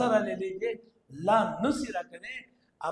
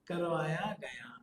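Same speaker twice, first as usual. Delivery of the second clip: whisper; 4 semitones lower, 7 dB quieter than usual. Shown as a demonstration. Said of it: "ये बहुत जरूरी है मजहूल के लिए".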